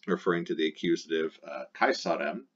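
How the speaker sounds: usual speaking voice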